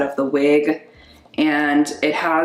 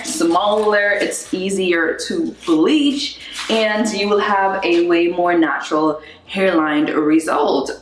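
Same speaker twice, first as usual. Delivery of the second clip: normal talking voice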